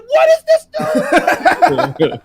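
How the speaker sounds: very high, drawn-out squeal of disbelief; astonished shout. Retold or imitated. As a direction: yell it loud, breaking into laughter. Reliving astonishment, cracking up.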